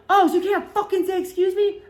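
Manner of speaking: put-on high, loud snippy bar girl voice; mocking angry girl. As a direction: imitate her snotty challenge. Girl snaps at them in bar.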